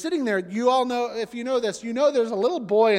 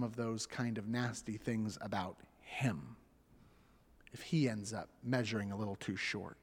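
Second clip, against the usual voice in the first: gentle questioning; 8 semitones lower, 9 dB quieter than usual. Retold or imitated. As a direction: lead her to the answer softly. Mother gently questioning her daughter.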